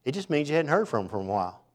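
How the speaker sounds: ordinary talk, nothing marked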